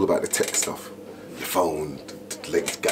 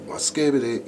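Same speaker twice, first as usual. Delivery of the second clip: light, higher MC catchword; high-pitched MC voice; 8 semitones higher than usual. Retold or imitated. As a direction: pop it up high, imitating your own MC style. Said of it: "my high, more higher pitch thing".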